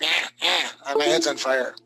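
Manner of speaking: cartoon teen voice, dumb and panicky; Beavis and Butthead. Teacher mocks a drawing's face, cartoon teen voice. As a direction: ham up a cartoon teen with his head on fire. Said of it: "it's like Beavis and Butthead".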